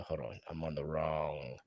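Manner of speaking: low, quiet mutter; muttering to oneself. Real, not acted. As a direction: mumble it under your breath while busy. Muttering while finding the screen.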